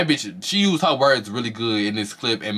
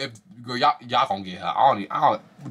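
ordinary delivery

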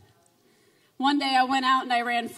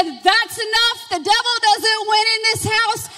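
put-on high, loud, commanding child; fierce rebuke; 8 semitones higher, 7 dB louder than usual. Her seven-year-old daughter rebukes the devil in a loud high voice. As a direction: command it like a fearless little girl.

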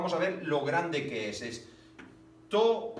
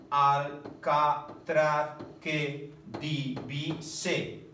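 slow syllable reading; much slower than usual. Shown as a demonstration. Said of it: "Una, dos".

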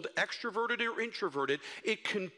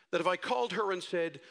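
usual speaking voice

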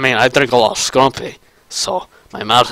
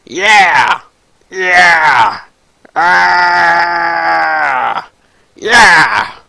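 higher Demoman yells; accent-finding shouts; 4 semitones higher, much slower than usual. Acted. Impressionist recovers a lost accent with repeated shouts. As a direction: belt out drawn Demoman cries to find the accent. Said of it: "I got my accent back".

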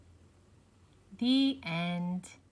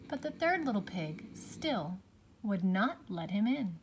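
usual speaking voice

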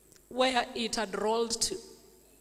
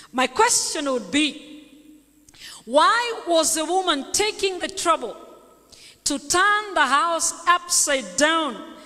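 raised, projected preaching voice; higher, louder preacher's challenge; emphatic preaching; 5 semitones higher, 7 dB louder, slower than usual. Real, not acted. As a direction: pose the question forcefully to the congregation. Preacher poses her question.

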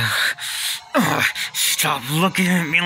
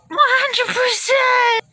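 high, slow, loud mock wailing; hammed-up wail; 5 dB louder, slower than usual. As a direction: overact the sorrow in a slow wail. Overdramatic wailing.

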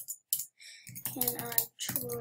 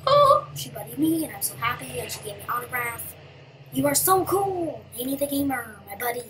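gushing fan; 10 dB louder than usual. Pretend. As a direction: pretend to brag like a thrilled fan. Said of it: "I'm gonna be like".

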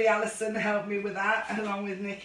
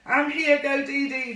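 normal talking voice

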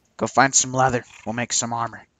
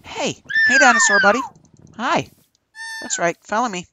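much higher, loud sing-song lure; coaxing call; 5 dB louder than usual. Real, not acted. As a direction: bait the dinosaur playfully. Luring the dinosaur, calling.

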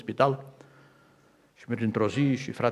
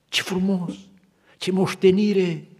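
awed exclamation; 9 semitones higher, 4 dB louder, slower than usual. Retold or imitated. Woman recalling heaven's beauty.

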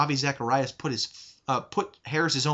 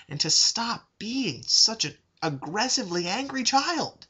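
getting angry; 9 semitones higher than usual. Real, not acted. Scolding the atheist YouTuber, anger rising high. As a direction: voice jumps much higher in rising anger. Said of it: "I'm sorry that I got angry".

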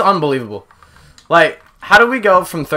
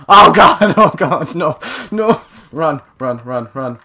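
frantic raised outburst; panicked shouting; 3 semitones higher, 8 dB louder, slower than usual. Real, not acted. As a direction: yell in sudden game panic. Sudden panic in game.

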